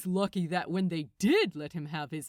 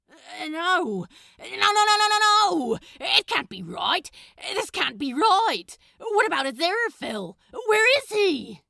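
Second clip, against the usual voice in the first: much higher and louder frantic cries of no; rising panic, disbelief breaking through; panicked denial; 9 semitones higher, 13 dB louder than usual. Acted. Crowley sees London burned.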